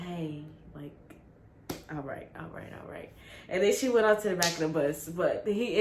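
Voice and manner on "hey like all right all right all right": soft, low all right all right, backing away; awkward quiet brush-off. Retold or imitated. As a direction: mumble it to get rid of her. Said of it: "And I was like".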